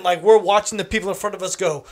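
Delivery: ordinary talk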